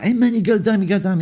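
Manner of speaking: a hint of a street beggar pestering; tramp hassling. Tramps on the street pestering for money, mock pushy voice. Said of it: "and they'd be like".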